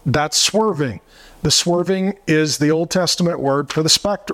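ordinary talk

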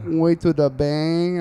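slow, drawn-out Brazilian "Oi to the bang"; Brazilian Portuguese phone greeting. Retold or imitated. [name] imitating his girlfriend's phone greeting, slow Brazilian. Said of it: "the way she says it on the phone".

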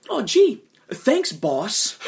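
high, loud mock-grateful burst; mock gratitude. Sacrifice to the leader at the ladder, mock gratitude. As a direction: thank the boss with hammed-up gratitude.

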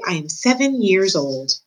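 ordinary delivery, nothing marked

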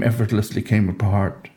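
ordinary delivery, nothing marked

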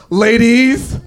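high, drawn-out call to a crowd. Pretend. Comic bragging to the women in the audience, calling out.

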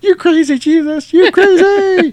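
very high cartoon yell; over-the-top action parody. Cartoon character in an action spoof, high exaggerated yell. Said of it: "it's always over the top".

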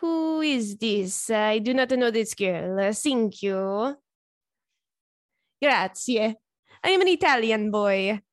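hammed-up Italian accent, slow and deliberate; dodging the papers with a fake Italian accent; mock Italian accent. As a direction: play dumb as a fake Italian tourist. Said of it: "'Cause I'm gonna say".

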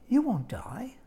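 hushed, unvoiced tempting whisper; serpent whisper. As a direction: breathe it like a secret.

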